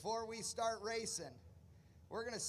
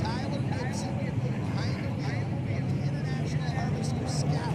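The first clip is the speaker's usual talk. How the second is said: booming over the loudspeaker; PA announcer; 12 dB louder than usual. Real, not acted. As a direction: call it out over the PA. Announcing cars over loud PA.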